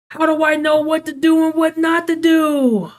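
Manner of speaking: high, loud exasperated shouting; frustrated yell. Real, not acted. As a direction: shout it in real disbelief. Software fails, frustration building.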